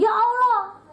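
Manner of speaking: high, loud mock wail of "Ya Allah"; soap opera wail. Mocking a soap opera mother crying out to God, overdone and loud. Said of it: "kayak di sinetron".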